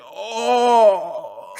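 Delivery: loud, high mock groan of stomach pain. Kid at the carnival with food poisoning, mock groan. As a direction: groan like your stomach is churning. Retold sick kid on the couch.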